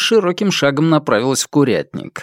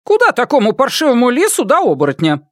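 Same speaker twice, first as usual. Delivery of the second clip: put-on scoffing young woman; 10 semitones higher than usual. A narrator's reading. Wild Donkey scoffs.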